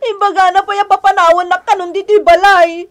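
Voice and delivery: tearful complaint, sobbing through the words; crying as she tells what was said; wife sobbing to her husband. Acted. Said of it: "Nagsangsangit ni Roda".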